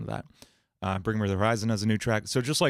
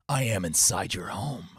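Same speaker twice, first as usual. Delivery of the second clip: lower, menacing whisper-like creep; creepy intruder; 4 semitones lower than usual. Retold or imitated. Threatening someone from inside their home, low creepy voice. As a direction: speak like a stalker in the house. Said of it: "Or the intruder".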